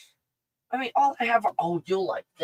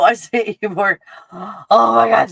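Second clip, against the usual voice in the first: ordinary talk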